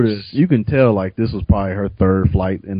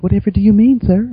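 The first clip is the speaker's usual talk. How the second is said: mock innocence; 9 semitones higher, 3 dB louder than usual. Stewardess feigning innocence.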